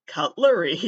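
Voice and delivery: gleeful, unsettling sales pitch; creepy salesman. Pretend. Podcaster joking about selling knives, unsettling glee.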